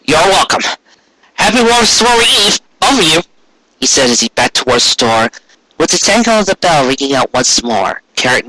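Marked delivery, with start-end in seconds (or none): 0.1-3.2 put-on young colt voice. Shy colt voiced in story.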